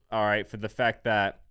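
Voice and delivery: ordinary talk, nothing marked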